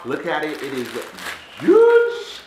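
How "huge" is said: very high, loud, drawn-out marvel; excited squeal. Real, not acted. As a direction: squeal it in sheer amazement. Showing off the giant candy.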